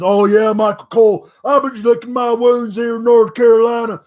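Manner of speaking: loud, casual mock wrestler promo, lightly voiced; hammed-up backstage promo, friendly and pumped; imitating Braun Strowman. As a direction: call out to the announcer like a fired-up big man. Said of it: "where he says".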